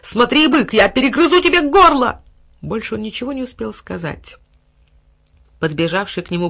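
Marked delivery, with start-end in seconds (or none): 0.0-2.2 high, loud warning; heated fighting cry; fierce threat. A narrator's reading. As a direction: threaten the enemy at raised voice. Dog threatens bull mid-fight.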